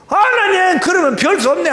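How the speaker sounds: high, loud, exasperated; exasperated shout. Retold or imitated. Deacon yelling in exasperation at God. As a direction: throw up your hands and yell.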